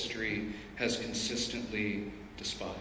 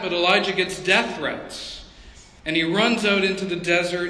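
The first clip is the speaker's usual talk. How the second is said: ordinary talk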